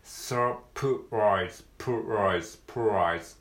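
プライズ with P and R run together, no vowel between; pronunciation drill; PR cluster. Shown as a demonstration. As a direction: glide from P straight into R in プライズ. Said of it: "この辺が要注意ですね".

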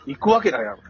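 retold blunt refusal. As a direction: brush the offer off curtly. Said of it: "って言ってたわ".

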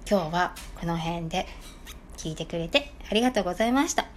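normal talking voice

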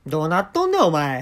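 luide, hoge mock uitbrander; geïmiteerde uitval. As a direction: roep het als een geïmiteerde berisping. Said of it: "ツッコミの言葉".

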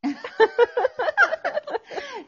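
loud high burst of laughter. Real, not acted. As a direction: crack up laughing. Laughing at sesame oil story. (大爆笑)

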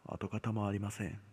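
ordinary delivery

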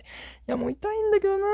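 very high, thin voice for a silent complaint; squeaky inner thought. Retold or imitated. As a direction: squeak it out like a private whine. Inner thought during painful bleach. (心の声を高く細く)

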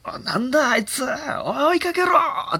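high, slow comic call to give chase; cartoonish shouted chase call; excited herd elephant. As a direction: play an elephant rallying the others.

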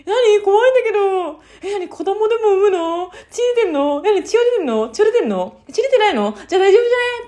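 high, loud, rapid questioning; a startled, then dismissive sister; sister's alarmed questions. Retold or imitated. A woman imitating her sister asking if she's bleeding. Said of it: "って言って".

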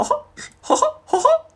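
cartoon mouse giggle; Mickey laugh. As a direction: chirp the high Mickey laugh way up. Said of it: "ちょっとやってみよ".

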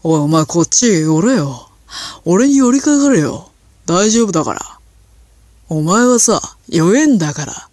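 put-on deliberately resonant throaty voice of a bossy drunk man; booming macho drunk. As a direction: put on a chest-voice swagger like a tipsy tough guy. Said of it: "すっごい響く声でこう、わざと喉を響かせながら".

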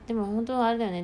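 ordinary delivery

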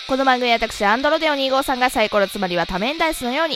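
loud, fast announcer intro over music; radio intro. Acted. Host opening his own radio show, announcer voice.

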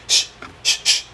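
short unvoiced shh bursts like a boxer throwing jabs; boxer's breath hiss. Imitating a boxer jabbing, quick hissed breaths. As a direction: puff out breathy punch sounds. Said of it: "ボクシングの人ってようこんな声出す".

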